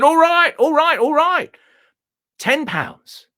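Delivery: high, loud, hurried climb-down; caving in fast on the price; hasty haggling. Acted. The owner gives in to the buyer, high and loud.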